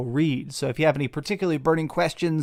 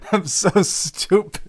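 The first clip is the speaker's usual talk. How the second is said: laughing at himself; 5 semitones higher, slower than usual. Real, not acted. Laughing at own mask fail.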